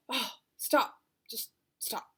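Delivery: exasperated outburst dropping to a whisper; overwhelmed. Retold or imitated. Mom quitting her chore system, overwhelmed and fading out. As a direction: give up, voice falling away to breath. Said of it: "I'm overwhelmed".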